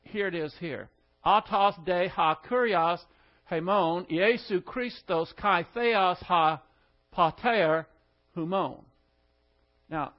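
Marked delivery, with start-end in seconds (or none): 1.2-8.8 Autos de ha kurios hemon spoken as Greek; reading Greek aloud. Shown as a demonstration. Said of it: "this is the Greek order of the words".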